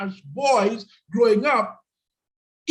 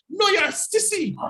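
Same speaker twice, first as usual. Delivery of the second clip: "sissy" thrown out higher, as a jeering shout; mock taunt; 6 semitones higher than usual. Retelling what society yelled at boys, mock jeering shout. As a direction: taunt him like the kids and elders did. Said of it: "That is what society told us".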